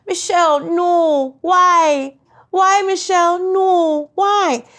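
high, loud exasperated mom; Jamaican mom scolding. Retold or imitated. Mom scolding daughter Michelle, loud high exasperated wail. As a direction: wail her name like a fed-up Jamaican mother. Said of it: "the one thing she's told me".

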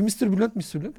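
mock Chinese accent. Caller from Huawei phoning, in a lightly mimicked Chinese accent. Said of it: "Çinli aksanıyla".

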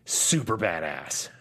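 slow, low tough-guy voice; mock badass. Shown as a demonstration. Host describes action stars when needed, mock badass. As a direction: drop low and stretch it out.

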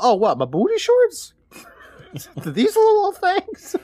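high, loud playful mock innocence; coy wife act. Acting [name] showing off to husband.

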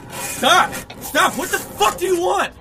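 screaming high and loud; terrified screaming. Acted. Facing the creature at his door, terrified screaming. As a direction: scream at the attacker in terror.

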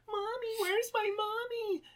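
put-on very high, slow whimpering child; crying little girl. Podcaster mimicking the girl in the movie, high teary whine. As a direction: whine for mommy like a scared kid. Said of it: "there's this one girl like".

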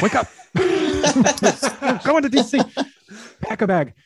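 high, loud retold shout to rouse a sleeper; shouting someone awake with big news; excited wake-up yell. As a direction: yell it excitedly, laughing as you retell it. Rousing sleeping wife with big news.

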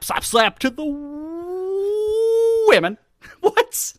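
high drawn-out mock voice on slap; mocking the pick in a squeaky voice; mock high squeal. Podcaster mocking Packers fans, high squeaky voice.

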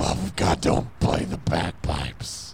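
low devil whisper. Acted. Satan pleading.

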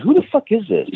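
mock angry, pitch shooting up; retold frustrated outburst on the phone; exasperated. Caller still won't identify himself.

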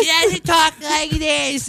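hammed-up high voice, words drawn out; mock mom voice. Son denies his mom sounds so, high mock voice. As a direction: echo a silly high, slow voice. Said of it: "she should talk like that".

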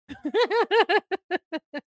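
high laugh; high happy laugh; real burst of laughter. Real, not acted. Laughing at Destiny.

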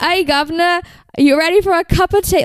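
mock London geezer, deliberately bad Cockney; cheeky put-on Cockney accent. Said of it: "he would put on a really thick Cockney accent".

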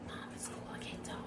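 mock hushed whisper of a kid in class. Imitating her daughter brushing her off, in a whisper. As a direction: whisper it like you can't be overheard. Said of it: "that's what Cadence gives me all the time".

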